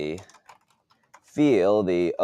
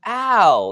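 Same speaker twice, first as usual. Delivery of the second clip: high, loud "ow" of pain; pained cry; 13 semitones higher, 5 dB louder than usual. A narrator's reading. Timid victim just smacked, crying out high and loud. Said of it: "owner of the timid voice cried".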